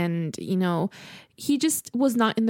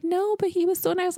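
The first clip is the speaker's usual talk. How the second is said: mock sad protest; 10 semitones higher than usual. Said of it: "And she was so sad".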